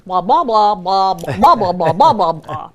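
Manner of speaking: loud droning blah blah; Charlie Brown teacher. Retold or imitated. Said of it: "the teacher in the Charlie Brown series".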